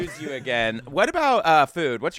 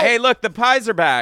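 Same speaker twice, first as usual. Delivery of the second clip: high, loud excited shout; excited call; 10 semitones higher, 6 dB louder than usual. Acted. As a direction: point them out with a yelp. Excited spotting pies.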